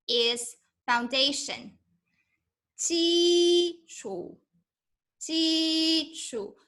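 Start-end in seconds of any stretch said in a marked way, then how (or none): 2.8-6.6 基础 with both syllables high and level, first tone; Mandarin 基础. Shown as a demonstration. 老师示范基础，同样的声调. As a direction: keep 基础 high and flat like 薪水. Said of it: "I use the same tone".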